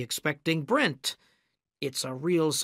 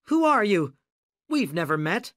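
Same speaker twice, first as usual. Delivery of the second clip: high, loud, alarmed challenge; frightened; 5 semitones higher, 4 dB louder than usual. A narrator's reading. Studio boss to gangster he never met, frightened. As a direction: challenge the stranger in a high, scared voice. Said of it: "in a high, frightened voice".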